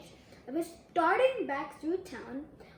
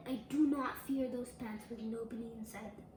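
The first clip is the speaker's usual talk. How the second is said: quiet false bravado; 6 semitones lower, 8 dB quieter than usual. Acted. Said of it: "I said and said and said those words".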